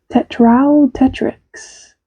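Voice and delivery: slow savoring. Shown as a demonstration. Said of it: "that is an incredible Latin name".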